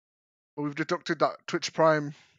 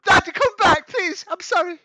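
put-on pleading child; 13 semitones higher, 5 dB louder than usual. Begging father to come back.

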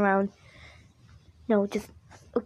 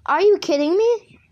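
high, loud incredulous question; exasperated disbelief; 8 semitones higher, 5 dB louder than usual. Real, not acted. Player sent back by the game, exasperated disbelief. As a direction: protest in disbelief, rising at the end.

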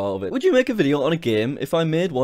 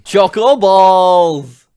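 loud, slow, stretched shout; drawn-out shout; 5 semitones higher, 12 dB louder, much slower than usual. Acted. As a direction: belt out the words slowly.

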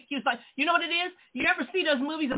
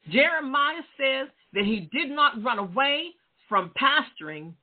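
normal talking voice

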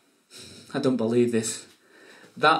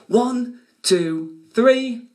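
excited countdown; 11 semitones higher, 5 dB louder, much slower than usual. Acted. Count-in before magic words.

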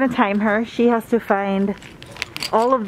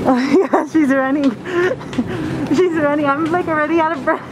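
breathless laughing, higher; 5 semitones higher than usual. Real, not acted. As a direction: giggle and pant through it. Laughing while racing friend.

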